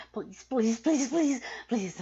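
urgent begging. Acted. Sub begging her master, loud and urgent. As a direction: beg hard, each please pushed out.

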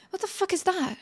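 ordinary delivery

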